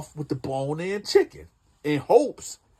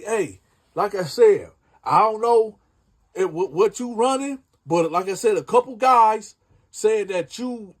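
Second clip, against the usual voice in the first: retold threat, higher and louder; threatening; 6 semitones higher, 4 dB louder than usual. Sonny warning Ebon to pay up, louder retold threat. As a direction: lean in and warn him hard.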